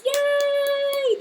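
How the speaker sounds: high, loud squeal of joy; excited yay. Real, not acted. Cheering a viewer's shout-out, high excited squeal.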